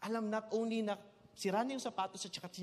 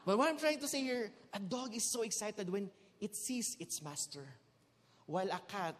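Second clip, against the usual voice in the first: ordinary talk, nothing marked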